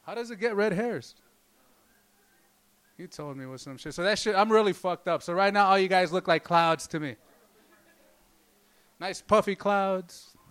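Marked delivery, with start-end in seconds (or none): none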